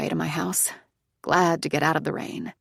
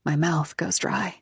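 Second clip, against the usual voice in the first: ordinary delivery, nothing marked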